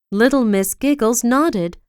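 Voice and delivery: ordinary delivery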